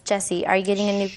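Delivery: usual speaking voice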